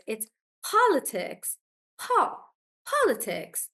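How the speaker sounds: politics with po stressed. Shown as a demonstration. Teacher models politics for the class, po stressed. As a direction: lean on po in politics. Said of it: "it's on the first one".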